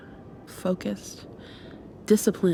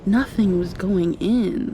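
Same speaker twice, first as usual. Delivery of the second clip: ordinary talk, nothing marked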